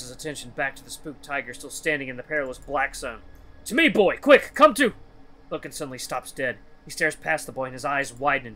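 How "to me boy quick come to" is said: high, loud shouted command; urgent summons. Acted. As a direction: yell to fetch someone out of danger. Loken summons boy from danger.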